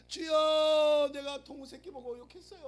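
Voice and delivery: very slow, loud, high drawn-out cry; hammed-up long prayer wail; mock prayer wail. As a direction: stretch the Lord into a long wail.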